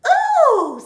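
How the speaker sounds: very high, loud delighted oh; Betty's delighted oh. Acted. Betty recognises Bill, delighted. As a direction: squeal in recognition. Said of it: "says Burglar Betty".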